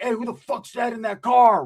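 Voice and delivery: slow, menacing put-on voice; mock tough guy challenge; tough homeboy. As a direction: growl it out like a vato. Scary homeboy at trailer door.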